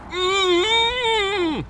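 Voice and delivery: high muffled "Mm" through a gag; wordless pleading moan behind closed lips; gagged cry. Acted. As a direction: struggle to cry out while gagged. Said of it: "struggling to talk through the gag".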